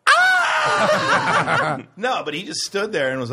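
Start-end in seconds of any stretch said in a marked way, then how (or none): 0.1-1.8 very high, loud scream; panicked scream. Pretend. Himself as president ducking a shoe, high loud scream. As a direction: shriek in mock terror. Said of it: "I'd go".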